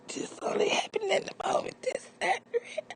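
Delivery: very high, airless crying whisper; pinned on the ground, crying out in a strained whisper; strained whimper. Retold or imitated. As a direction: squeeze it out like you can't breathe. Pinned down, can't breathe.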